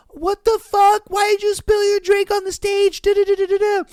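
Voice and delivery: high, loud, outraged yell; furious and shrill, scolding him; angry dancer. Acted.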